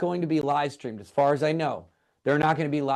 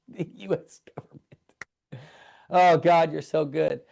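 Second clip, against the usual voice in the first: a laugh turning into a loud cry of thanks; overwhelmed praise; 4 semitones higher, 5 dB louder than usual. Real, not acted. Pastor on livestream thanking God, bursting with joy.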